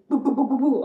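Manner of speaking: loud boo boo for pimples popping up; popping sounds. Retold or imitated. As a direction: mimic spots bursting out one by one. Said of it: "you just see all of them coming out".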